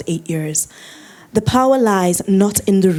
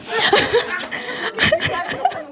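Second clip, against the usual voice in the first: high, trailing peal of laughter; 4 dB quieter than usual. Real, not acted. Speaker amused by colleague wanting to play with avatars, laughing. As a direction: burst out in high-pitched laughter.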